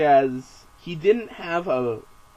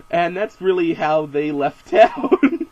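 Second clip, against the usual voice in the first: laughing; 3 semitones higher, 5 dB louder, slower than usual. Real, not acted. Narrator cracks up at his own story.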